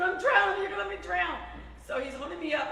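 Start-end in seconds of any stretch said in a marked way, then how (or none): 0.0-1.4 higher, louder mock scream; retold screaming. Telling the hosts how she screamed at her husband, mock scream. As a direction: replay the pool panic at full pitch. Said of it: "Now I'm screaming".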